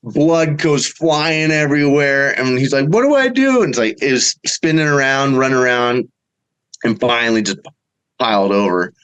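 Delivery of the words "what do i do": panicked question. Retold or imitated. Son panics as deer spins bleeding, frantic high cry. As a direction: squeak it out in sudden panic.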